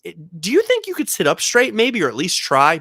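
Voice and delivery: high, sweet therapist voice, coaxing a patient; therapy voice. Retold or imitated. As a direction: gently coax like a patient therapist. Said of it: "that's my therapy voice".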